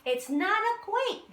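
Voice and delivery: amazed chorus. A narrator's reading. The whole group echoing the moles, high and amazed. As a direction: echo it high in disbelief. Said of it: "they all said".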